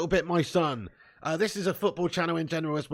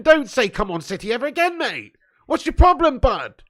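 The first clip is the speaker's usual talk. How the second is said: high, loud, hammed-up scolding; mock telling-off; 7 semitones higher, 6 dB louder than usual. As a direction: bellow in mock horror at the fan. Scolding a viewer for "Come on City.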